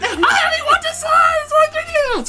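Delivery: very high squealing voice; high excited squeal over a handheld console; squealing fan. Retold or imitated. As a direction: squeal it like a giddy kid.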